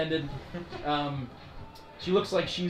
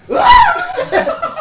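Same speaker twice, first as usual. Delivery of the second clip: high loud laughing shriek; a hammed-up scream while laughing; mock scream; 14 dB louder than usual. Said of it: "you hear the hooker go".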